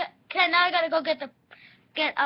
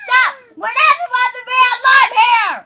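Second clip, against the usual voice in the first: loud bossy line; 6 semitones higher, 18 dB louder than usual. Real, not acted. Loud play instruction.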